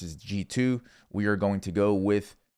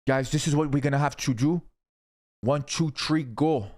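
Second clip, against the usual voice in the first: put-on higher, vaguely French Canadian accent; 6 semitones higher than usual. Rallying viewers in a mock French Canadian accent. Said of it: "I don't even know what accent that is".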